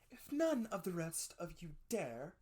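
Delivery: a hint of a bold mother; mother's voice. A narrator's reading. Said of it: "she said".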